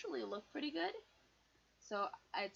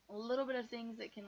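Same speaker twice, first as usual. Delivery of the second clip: usual speaking voice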